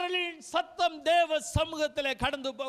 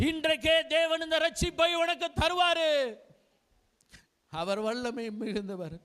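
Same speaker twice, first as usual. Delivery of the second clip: fervent sermon voice, rising and falling; impassioned Tamil preacher's call; preaching; 6 semitones lower than usual. Acted. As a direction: urge the congregation to live for God. Preacher exhorting the congregation.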